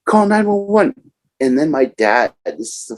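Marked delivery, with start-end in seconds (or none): 0.0-0.9 panicked family. Retold or imitated. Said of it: "'cause they're like".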